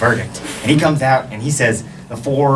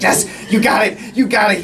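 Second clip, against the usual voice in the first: higher-pitched, half-voiced cheering; mock screaming cheer; 7 semitones higher than usual. Family cheering brother toward the goal, mock screaming. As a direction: cheer him on in a held-back scream. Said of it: "We're all screaming at him".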